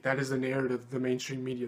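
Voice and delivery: usual speaking voice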